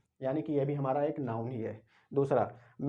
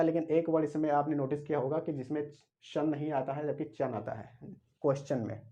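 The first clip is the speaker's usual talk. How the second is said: ordinary talk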